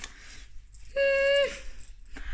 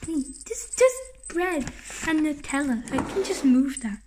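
usual speaking voice

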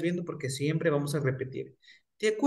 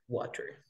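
Water whispered with the stress on wa; soft Water, wa stressed; whispered stress demo; 11 dB quieter than usual. Shown as a demonstration. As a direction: breathe Water, weight on wa. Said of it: "Dónde lleva la fuerza de voz".

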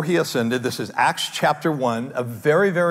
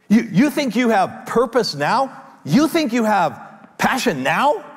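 mock challenge; 7 semitones higher, slower than usual. Preacher voicing Jesus challenging hearers.

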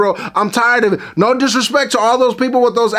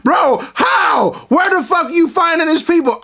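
exasperated yell; 6 semitones higher than usual. Real, not acted. Exasperated outburst at Mad.

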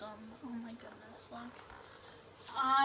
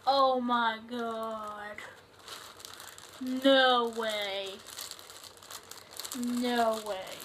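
slow, stunned oh my god; can't believe what's in the bag; real stunned surprise; stunned disbelief; much slower than usual. Real, not acted. Opening a shirt in a grab bag.

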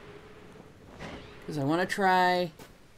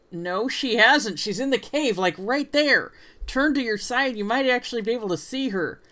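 excited; 7 semitones higher, 5 dB louder than usual. Real, not acted. Player contradicts game character.

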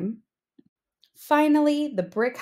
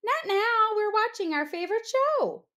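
very high little pig voice; dismissive pigs; 11 semitones higher than usual. Acted. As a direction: brush him off in a high squeaky voice.

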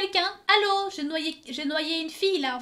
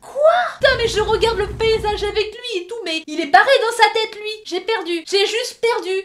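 loud, higher indignant outburst; outraged rant; 7 semitones higher, 11 dB louder than usual. Real, not acted. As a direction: rant about being rejected. Outraged rant after rejection.

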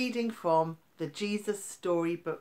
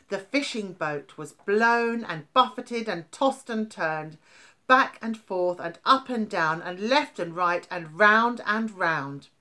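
louder, higher, building storm telling; dramatic storm narration; 3 semitones higher, 8 dB louder than usual. A narrator's reading. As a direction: make the storm roar as you read.

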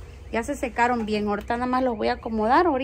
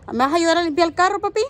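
higher, louder, fast and eager; 5 semitones higher, 5 dB louder, faster than usual. Real, not acted. Inviting her little son to help. (con entusiasmo, más agudo, rápido y fuerte)

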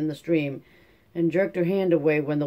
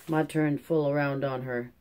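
plain reading voice

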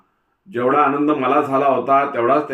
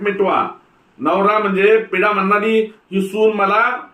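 much higher, louder shrill tirade; furious complaint; 9 semitones higher, 5 dB louder than usual. A narrator's reading. Mother-in-law complaining to her husband, furious and high. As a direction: rail at the husband about the bride. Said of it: "सासूने एकदम आवाज चढवला".

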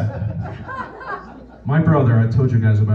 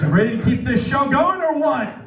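high, rousing host call; crowd hype; 10 semitones higher, 3 dB quieter than usual. Real, not acted. Comedian hypes up the audience. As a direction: pump up the crowd. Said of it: "hypes the crowd".

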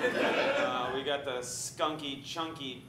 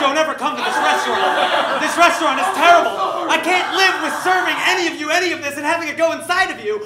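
much higher and louder, half laughing; desperate screamed plea; 13 semitones higher, 18 dB louder, faster than usual. Acted. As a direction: scream the warning at the audience. Chef screams warning to viewers.

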